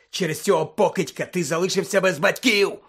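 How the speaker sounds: loud, heated reproach; rasped anger. Acted. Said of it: "прохрипів Блек".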